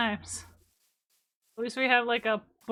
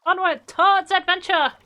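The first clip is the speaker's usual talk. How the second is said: rallying shout; 6 semitones higher, 8 dB louder than usual. Real, not acted. Rallying cry while sailing off.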